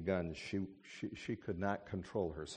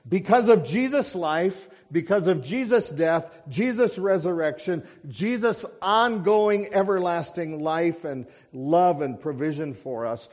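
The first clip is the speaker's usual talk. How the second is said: loud, higher, driving pulpit voice; fiery preaching; 10 semitones higher, 13 dB louder than usual. Acted. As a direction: proclaim it to the congregation with fire. Preaches the blessing at full force.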